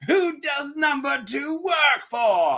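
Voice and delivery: high, slow, drawn-out yell; hammed-up movie interrogator bellow; mock interrogation shout. Host parodying an interrogation line, mock shouting.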